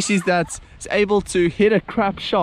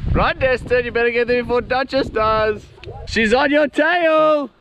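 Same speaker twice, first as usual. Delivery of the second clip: excited cheering; 8 semitones higher than usual. Real, not acted. Cheering on friend.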